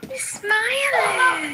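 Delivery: high, very drawn-out croon. Real, not acted. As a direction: chirp sweetly to the dogs, stretching each word. Sing-song talk to barking dogs.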